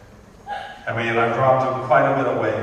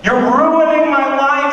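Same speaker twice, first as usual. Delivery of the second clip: put-on bratty teen whine; 13 semitones higher, 4 dB louder than usual. Comic sketches daughter yelling at her proud mother, high whine. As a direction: wail it like a spoiled sixteen-year-old.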